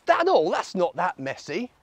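ordinary delivery, nothing marked

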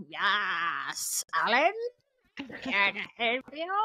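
lightly voiced drooling servant; a hint of a lopsided, drooling bell-puller; Fergus impression. Podcaster mocking a castle servant answering the bell, drooling servant voice. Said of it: "one eye with, like, drooling outside".

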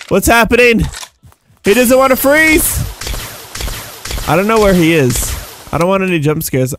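ordinary talk, nothing marked

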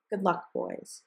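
plain reading voice

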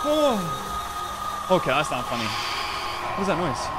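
ordinary delivery

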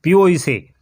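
loud, sharp order; angry shouted order to drink; thundering command. A narrator's reading. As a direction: bark the order down at him. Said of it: "कडाडला".

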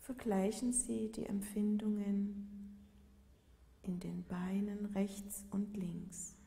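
calm yoga nidra voice, long unhurried pauses; guided meditation. Real, not acted. Yoga teacher guiding listeners lying in Shavasana, calm meditative delivery. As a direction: lead a body scan gently, leaving space after each phrase.

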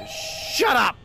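high, loud frustrated yell; shouting. Real, not acted. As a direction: scream it at the screen. Yelling at game.

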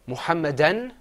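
"Muhammadan" loud, rising at the end; "Muhammadan" as first of a list to repeat; repeat-after-me model. Shown as a demonstration. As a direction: project "Muhammadan" with a lifted ending. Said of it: "repeat after me".

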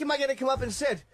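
high-pitched questioning quote; retold invitation. Comic retells Jerry asking if he is coming up, high questioning. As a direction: lightly imitate someone asking, voice lifted. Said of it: "he says to me".

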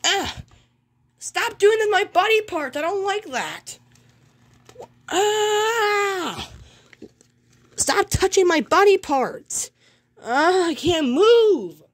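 high-pitched cartoon outcry; acted distress. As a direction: cry out in pretend panic, high and strained. Mickey cries out while being bullied.

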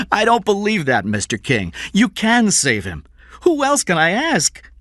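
high, loud, urgent begging; desperate pleading father. A narrator's reading. Father begs his employer to save his son, desperate pleading. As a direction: beg with rising desperation, pitched high.

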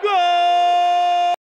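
high loud held GOL; goal shout. Real, not acted. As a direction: belt out the goal.

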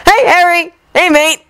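high-pitched boisterous hail; calling out. Acted. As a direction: hail a friend across the room in a high character voice. Twins hailing Harry as they approach.

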